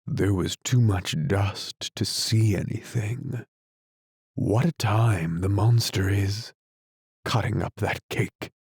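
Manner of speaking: ordinary delivery, nothing marked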